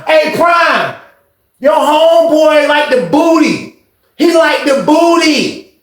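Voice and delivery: high, slow, drawn-out jeering; retold taunting. The boy refusing to leave while being dragged off, high jeer. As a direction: hold your ground and mock. The boy resisting the old head.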